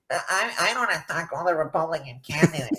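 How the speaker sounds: mocking a timid, whiny politician; whiny DeSantis imitation. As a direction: play him meek and whiny. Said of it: "like, you sound like a pussy".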